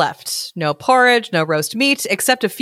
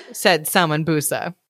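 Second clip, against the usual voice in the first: usual speaking voice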